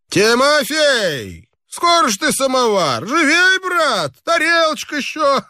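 high, hearty call to the waiter; a reveller hollering for the samovar; rowdy guest. A narrator's reading. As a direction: summon the servant like a merry drunk.

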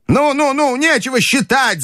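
put-on very high, hurried old lady; impatient grandmother. A narrator's reading. Grandmother refusing to count the money, impatient. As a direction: cut him off in a high granny voice. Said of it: "замахала она руками".

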